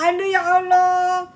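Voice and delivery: ordinary delivery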